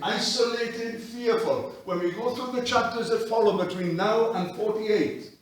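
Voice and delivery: loud pulpit preaching; full-force preaching. Acted. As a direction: press it on the congregation.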